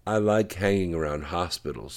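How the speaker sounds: lightly sarcastic fake enthusiasm; understated sarcasm. A narrator's reading. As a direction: say it with dry, mock approval. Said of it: "said Katie sarcastically".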